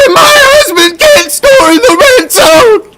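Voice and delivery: very high, slow wailing sob voice; hammed-up crying wife; mock bawling wife. Mocking the player's wife, hammed-up wailing sob.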